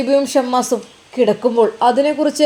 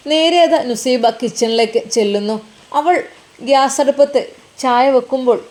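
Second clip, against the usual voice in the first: usual speaking voice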